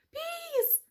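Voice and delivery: high startled mouse; a mouse calling up to the bees. Acted. Mouse addressing bees on his head, high.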